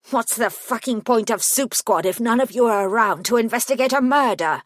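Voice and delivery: a grieving client lashing out in rage; furious gremlin rounding on the detective; angry outburst. A narrator's reading.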